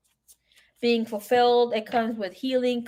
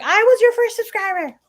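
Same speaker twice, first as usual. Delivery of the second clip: much higher, louder, mock-indignant sister; sister's proud claim; 12 semitones higher, 5 dB louder than usual. Imitating her sister claiming to be the first subscriber, high and proud. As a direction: playfully insist you came first. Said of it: "my sister, she's like".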